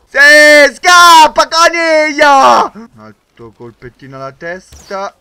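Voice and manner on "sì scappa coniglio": loud, high taunt, coniglio slowed; taunting shout. Real, not acted. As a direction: jeer at the runner. Taunting fleeing enemy. (derisione urlata, alta e forte)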